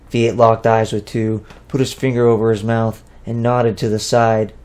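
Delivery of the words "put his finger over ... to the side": ordinary delivery, nothing marked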